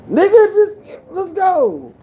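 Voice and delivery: high, loud hype yell; pumped-up, high-pitched cheer; excited outburst. Acted. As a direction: burst out high, urging it on. Acted reaction to nearing milestone.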